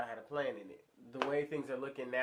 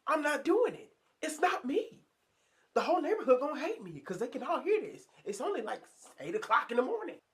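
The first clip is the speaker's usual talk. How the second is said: much higher, louder, rushed panicky squeal; mock panic; 14 semitones higher, 6 dB louder than usual. Man woken by his car horn panicking about the neighbors, squealing high. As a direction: freak out in a hammed-up high voice. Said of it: "I'm freaking out".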